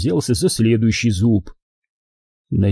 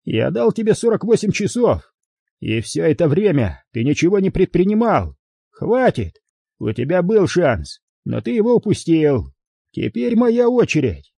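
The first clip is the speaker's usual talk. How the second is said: high-pitched, emphatic reproach; angry growl; 7 semitones higher than usual. A narrator's reading. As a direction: narrator voicing an angry ultimatum, pitched up. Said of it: "прорычал Харрисон". (гневная отповедь)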